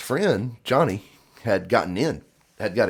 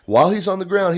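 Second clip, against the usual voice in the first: usual speaking voice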